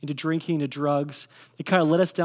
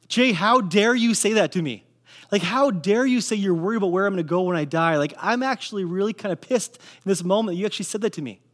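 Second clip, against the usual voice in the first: retold indignant outburst, louder and higher; indignant anger; 3 semitones higher, 4 dB louder than usual. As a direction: act out an offended comeback to a friend. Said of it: "your first reaction ... is to be angry".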